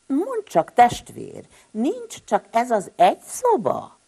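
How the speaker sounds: high, loud squeaky question; high sibling voice. Acted. Tihamér asks his brother about the single room, high and squeaky. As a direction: pipe up cheekily in a high voice. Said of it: "Kérdezte is Sün Tihamér".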